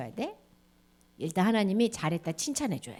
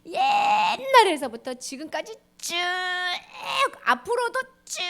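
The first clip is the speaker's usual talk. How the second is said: excited stretching; 7 semitones higher than usual. Acted. Teacher showing God's endless love, excited. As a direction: stretch it out with wonder. Stretching "all along" enthusiastically.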